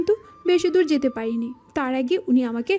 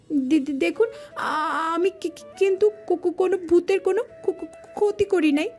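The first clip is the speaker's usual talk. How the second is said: higher, tearful stutter; terrified stammering plea; 3 semitones higher than usual. Acted. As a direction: beg while stumbling over each word. Terrified woman pleads with supposed ghost. (ভয়ে তোতলিয়ে মিনতি)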